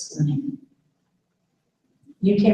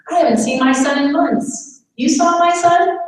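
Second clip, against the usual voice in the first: higher, louder disbelieving question; surprised patient; 6 semitones higher, 3 dB louder than usual. Retold or imitated. Caregiver quoting a patient, startled and higher. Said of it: "patient reacts".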